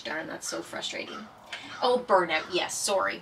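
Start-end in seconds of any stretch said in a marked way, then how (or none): 0.0-1.3 frustrated mutter. Real, not acted. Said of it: "I just lost my thought".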